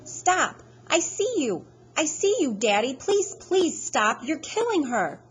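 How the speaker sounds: high, loud pleading scream at his father; a boy screaming at his father to stop choking her, desperate. Acted. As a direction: scream for him to stop, begging. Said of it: "I'm going".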